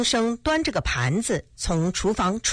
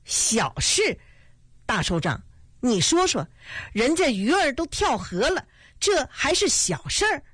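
rising, higher indignant outburst; annoyed; 6 semitones higher than usual. A narrator's reading. Father angrily protesting to the leader, voice climbing. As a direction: bristle at the word small. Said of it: "恼火地说".

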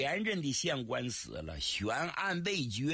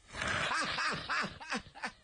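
high cackling laugh; 13 semitones higher than usual. Acted. Woman laughs at her own worry.

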